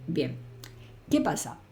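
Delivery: usual speaking voice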